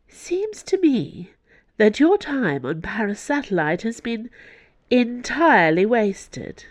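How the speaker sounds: high-pitched, angry cardinal. A narrator's reading. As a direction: scold an underling from on high. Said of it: "She was angry".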